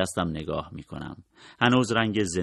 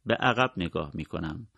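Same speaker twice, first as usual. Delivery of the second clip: plain reading voice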